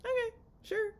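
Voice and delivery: high, airy "okay, sure" with no pushback; mock breezy agreement. Kirsten agreeing to Tyler, mocked as high and breezy. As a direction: sell it as if nothing's wrong, lightly. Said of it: "she's like".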